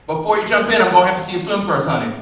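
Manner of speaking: loud, high bossy call-out; bossy clerk. Acted. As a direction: stop him with a bossy call. Clerk calling out at pool.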